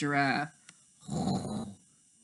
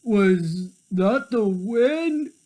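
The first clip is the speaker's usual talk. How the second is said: slow, drowsy leopard voice; groggy big cat waking; slow sleepy muttering cat; 3 semitones higher, 4 dB louder, much slower than usual. Acted.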